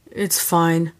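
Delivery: low, clipped-off sigh of a line; lightly voiced man, fed up; exasperated. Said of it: "ended in an exasperated tone".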